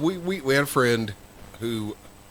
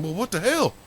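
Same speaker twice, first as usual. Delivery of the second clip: high-pitched baffled exclamation; imitated bafflement; 11 semitones higher than usual. Two friends looking around for what's missing, imitated bafflement. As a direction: act out puzzled disbelief, pitched up. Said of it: "they were looking around going".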